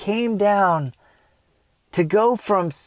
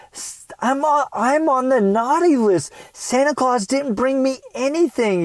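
put-on tearful boy; 7 semitones higher than usual. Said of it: "And I just started crying".

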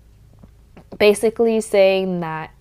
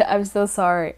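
normal talking voice

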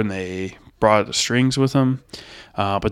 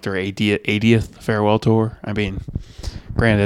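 ordinary talk

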